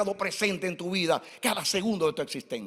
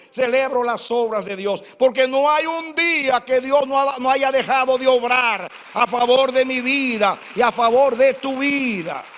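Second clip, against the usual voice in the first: louder, higher, pounding it home; preaching at full force; 4 semitones higher, 7 dB louder than usual. Acted. Preacher proclaiming to his church, loud and rising. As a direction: drive it to the congregation like a climax.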